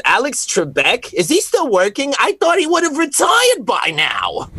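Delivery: high, incredulous Brooklyn diner waitress; hammed-up Brooklyn accent, rising in pitch. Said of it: "the flair of the accents come out".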